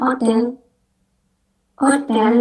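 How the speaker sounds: hotel dibaca otel tanpa H; hotel, H di depan dihilangkan; silent H. Shown as a demonstration. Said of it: "H-nya tuh nggak dibaca". (hotel tanpa H)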